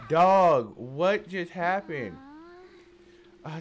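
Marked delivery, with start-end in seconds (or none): 0.1-2.2 a very loud startled yell; shocked outburst. Real, not acted. Gamer startled by the game, very loud yell. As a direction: blurt it out, caught off guard.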